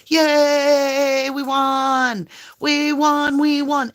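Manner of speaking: a victor at his parade, cheering; victory cheer. Retold or imitated. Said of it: "he goes out on the parade and he's like".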